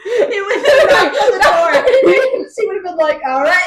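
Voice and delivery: high, loud words broken by laughter; laughing. Real, not acted. Friend laughing while picturing Feyre at the door. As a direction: crack up while telling it.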